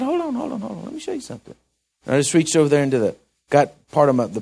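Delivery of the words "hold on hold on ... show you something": high, soft hold on, hold on; calming him down. Retold or imitated. Preacher calms a bristling inmate, high and soft. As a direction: settle a bristling man quickly.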